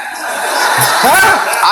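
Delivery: short wheezing laugh; breathy laugh. Real, not acted. Comedian laughing at Tyson asking where the club is, breathy. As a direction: giggle through it, mostly air.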